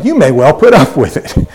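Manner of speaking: acted disbelief with swooping pitch; incredulous. Preacher showing how Paul says it to the Corinthians, incredulous. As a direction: put on Paul's amazed disbelief, amused. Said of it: "as if he's incredulous".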